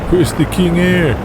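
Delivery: a hint of a cocky champ; Donnie voice.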